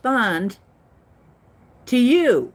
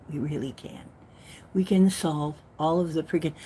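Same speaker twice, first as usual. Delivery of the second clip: soft unvoiced whisper; 3 semitones lower, 4 dB quieter than usual. Real, not acted. Speaker confiding to viewers that they really can, whispered. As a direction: breathe it like a secret. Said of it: "drops to a whisper".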